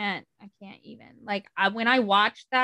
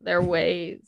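documentary voice; 4 semitones lower, 4 dB louder, slower than usual. Acted. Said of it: "This is my documentary voice".

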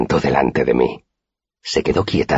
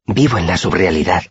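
ordinary delivery

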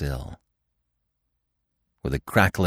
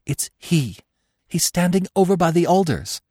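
high-pitched, alarmed report; breaking into high-voiced panic; frightened stammer; 11 semitones higher, 3 dB louder than usual. A narrator's reading. Marina telling the others where he is, frightened. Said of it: "she stammered".

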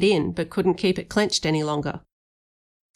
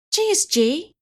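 high, voiced stage whisper; startled boy, pitch raised; mildly shocked, high exclamation; 11 semitones higher than usual. A narrator's reading. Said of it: "he whispered down to me".

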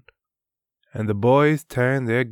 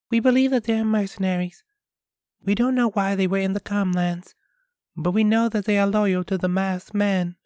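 put-on higher, quick young girl; a girl explaining her parents' fears, higher voice; 11 semitones higher, much faster than usual. Piola voiced in story.

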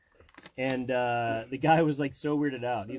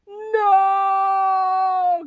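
long, much higher and louder wail of despair; anguished cry; 12 dB louder than usual. Retold or imitated. The reader whose glasses just broke, in a long hammed-up wail. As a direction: hold a sung, hammed-up NO.

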